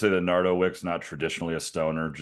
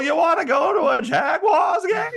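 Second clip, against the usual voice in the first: very high, loud shouted voice; Jacksonville impression; 7 dB louder than usual. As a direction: shriek the invite, way up high. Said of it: "everybody in Jacksonville talks".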